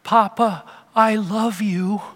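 put-on high, slow, teary little-girl voice; toddler crying through tears. Grandpa imitates crying granddaughter calling him, teary little voice. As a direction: plead sweetly like a sobbing toddler. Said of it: "through her tears, she goes".

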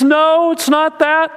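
high, slow, tearful protest. Retold or imitated. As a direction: plead through tears, slowly. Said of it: "through her tears".

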